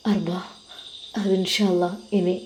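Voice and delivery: ordinary delivery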